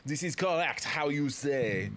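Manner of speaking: slow, put-on French accent; bad French accent. Frenchman confirming his identity, bad French accent. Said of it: "I don't have a good French accent".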